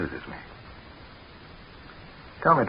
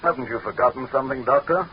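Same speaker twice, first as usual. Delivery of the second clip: high, pointed demand for respect; imperious rebuke; 5 semitones higher than usual. Acted. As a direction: remind him who's in charge. Leader demanding his title.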